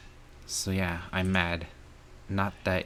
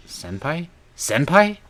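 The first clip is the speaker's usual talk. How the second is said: shrill rising cry, much higher and louder; shocked; 8 semitones higher, 10 dB louder, slower than usual. Acted. Learning senpai was behind it, in loud shock. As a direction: jump up in disbelief on the name.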